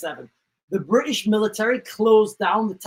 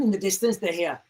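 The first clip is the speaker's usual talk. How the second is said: ordinary talk, nothing marked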